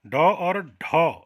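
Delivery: teacher underlining two letters; slow emphasis. Shown as a demonstration. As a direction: stretch each letter for the class. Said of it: "एक रोचक तथ्य और है बच्चों".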